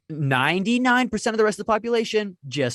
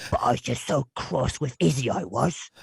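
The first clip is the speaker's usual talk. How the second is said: put-on British accent, pitched up then sinking; Nigel. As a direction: sign off as a cross British schoolboy. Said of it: "he'd end the video like".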